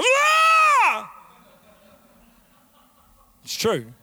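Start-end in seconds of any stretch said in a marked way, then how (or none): 0.0-1.1 long, high, loud scream; urge to yell. Acted. Parent fighting the old habit, acting out the yell. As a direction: burst out in a high-pitched yell. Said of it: "'cause you wanna go".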